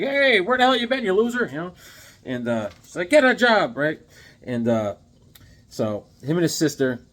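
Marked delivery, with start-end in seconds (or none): 0.0-1.5 high, loud nagging yell; hammed-up angry mom hollering; mock scolding mother. Hero's mother comes home, mock scolding yell. 3.1-3.8 loud, high-pitched nagging yell; hammed-up exasperated mom shout; mock scolding mother. Mother berating her son, mock scolding yell.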